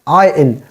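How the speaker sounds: "I N" as one word, iron with silent r; "I N" gliding from eye into in, no r; corrected iron. Shown as a demonstration. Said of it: "R is totally silent here".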